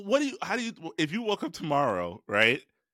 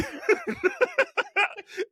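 helpless high-pitched laugh; high cackling laughter. Real, not acted. Podcast host cracks up over the accent story, high laughter.

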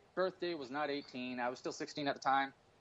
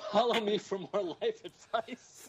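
slow put-on influencer sign-off; mock influencer; 4 semitones higher, 3 dB louder, slower than usual. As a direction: sell it like a guru. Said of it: "I'm trying to keep a straight face".

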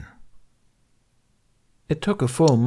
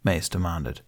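ordinary delivery, nothing marked

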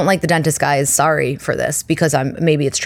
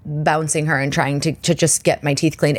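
ordinary talk, nothing marked

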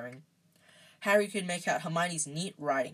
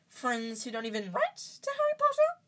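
high squeaky elf, slyly needling; high-pitched sly little elf; sly house-elf; 8 semitones higher than usual. A narrator's reading. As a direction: slip it in slyly in a high creature voice. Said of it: "said Dobby slyly".

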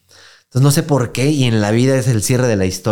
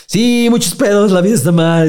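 high, slow, wailing lament; hammed-up drunk crying to his brother; mock drunk sobbing; 8 semitones higher, 5 dB louder than usual. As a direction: blubber it like a drunk crying about life. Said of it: "empecé a llorar".

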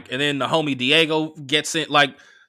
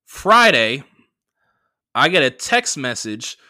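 normal talking voice

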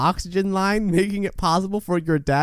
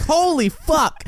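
shocked; 12 semitones higher, 3 dB louder, much slower than usual. Retold or imitated. Retold shocked reaction.

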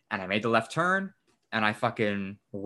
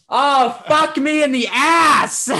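loud, high, drawn-out cry of frustration; wailing, fed-up yell; exasperated shout; 13 semitones higher, 8 dB louder, much slower than usual. Retold or imitated. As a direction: bellow it slowly at full exasperation. Exasperated outburst at bad news retold.

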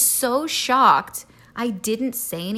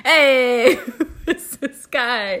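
loud mock heckle, then drawn out; imitating the mobster boyfriend; 4 semitones higher, 6 dB louder, much slower than usual. Backseat passenger calling out the driver, mock mobster. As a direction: heckle from the back seat, laughing. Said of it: "like this guy in the back".

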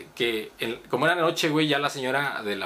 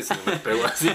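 laughing; 7 semitones higher than usual. Real, not acted. Laughing at own story.

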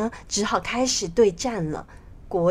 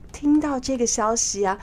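plain reading voice

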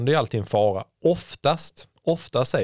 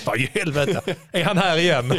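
higher voice, imitating annoyance through laughter; laughing mock irritation; 6 semitones higher than usual. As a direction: mimic the annoyed runner, amused. Replays irritated runner's reaction, joking.

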